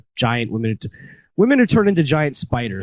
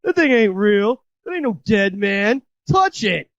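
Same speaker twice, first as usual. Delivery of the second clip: higher-pitched imitation of the laughing dude; scoffing dude; 8 semitones higher than usual. Dude dismissing the dead man to his girlfriend, high mocking imitation. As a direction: put on the movie dude's voice, pitched up. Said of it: "And he's like".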